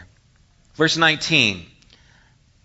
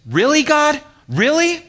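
very high, loud, rising disbelief; incredulous questioning; 6 dB louder than usual. Acted. Believer questioning God in frustration, high-pitched incredulous mock protest. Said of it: "maybe you say it like this".

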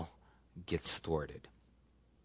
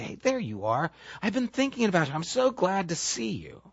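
softer, tender welcome; warm greeting; 7 semitones higher, 5 dB quieter than usual. Retold or imitated. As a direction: tell them gently you missed them. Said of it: "looking and say".

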